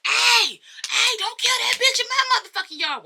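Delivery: high, loud shout across the yard; mock alarmed yell. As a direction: yell it high, imitating panic. Acts boyfriend calling out in alarm.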